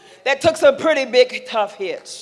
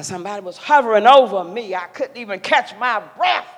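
ordinary talk, nothing marked

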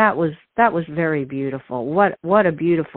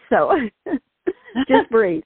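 getting choked up; 8 semitones higher than usual. Real, not acted. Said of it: "I'm about to get choked up".

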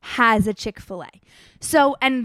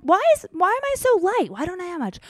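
much higher, puzzled why; puzzled alarm; 8 semitones higher than usual. Retold or imitated. Traveler notices she feels too light, puzzled.

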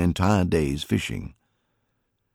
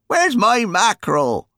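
put-on high, louder grumbling old man; contrary old uncle; 5 dB louder than usual. A narrator's reading. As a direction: whine it like a fussy old uncle wanting his fish. Uncle Billy contrary complaint.

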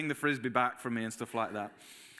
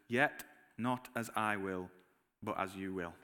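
surrendering prayer; 7 semitones lower, 5 dB quieter than usual. Acted. Jesus praying, voiced in sermon.

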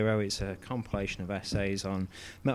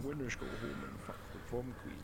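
Sean Connery impression; 13 dB quieter than usual. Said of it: "Sean Connery impression".

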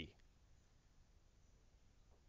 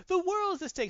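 dramatic stakes; 5 dB louder than usual. Retold or imitated. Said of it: "It's not like".

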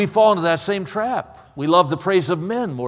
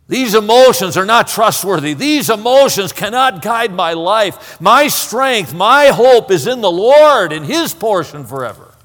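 loud, ringing resolve; emphatic declaration; 5 semitones higher, 7 dB louder than usual. Acted. Psalmist realizes his hope is in the Lord, loud and resolute. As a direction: declare it forcefully, punching the key words.

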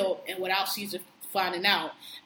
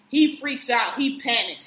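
ordinary talk

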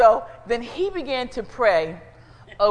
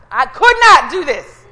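emphatic; 4 semitones higher, 13 dB louder than usual. Acted. Emphatic sermon line.

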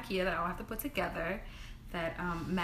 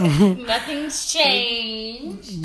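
drawn-out mock exasperation; 3 semitones higher, 12 dB louder, much slower than usual. Real, not acted. Teasing friend's self-obsession.

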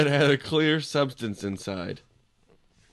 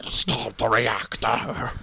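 Quato voice; much slower than usual. Acted. Total Recall Quato impression.

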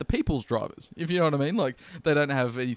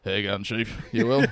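lower, gruff matey voice; hopping in the cab, lower blokey chat with the driver; blokey greeting; 5 semitones lower than usual. Pretend. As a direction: drop into a laconic Aussie bloke.

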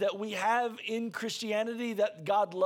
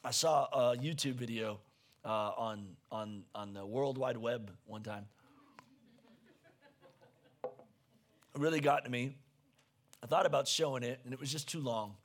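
low quiet aside; 11 semitones lower, 5 dB quieter than usual. Real, not acted.

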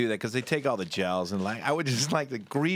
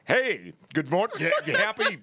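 faking sober; 5 semitones higher than usual. Retold or imitated. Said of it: "faking it too much".

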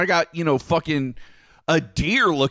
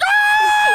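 loud high scream; startled scream; 7 dB louder than usual. Retold or imitated. Kid as bungeed freezer springs open, screaming. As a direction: shriek as the freezer pops open. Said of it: "And I'm like".

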